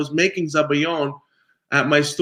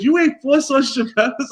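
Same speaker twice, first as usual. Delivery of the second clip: father's mock disbelief; 10 semitones higher than usual. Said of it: "he goes".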